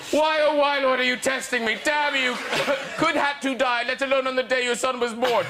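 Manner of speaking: high, theatrical mock lament; despairing fan. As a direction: plead with God in hammed-up anguish. Reads out a despairing fan's tweet.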